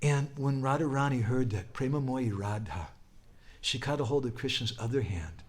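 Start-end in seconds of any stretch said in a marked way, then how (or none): none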